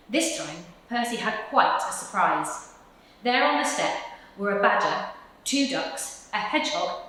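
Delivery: usual speaking voice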